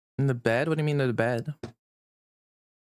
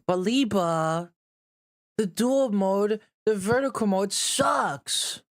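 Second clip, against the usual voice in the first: whiny complaint; 5 semitones higher, much slower than usual. Acted.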